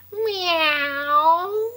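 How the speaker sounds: long, drawn-out, swelling meow; big cat meow. Pretend. Finding a big Chloe Cat, long drawn-out meow. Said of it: "Big one".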